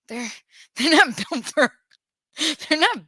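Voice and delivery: laughing through the words. Real, not acted. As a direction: giggle while saying it, half joking.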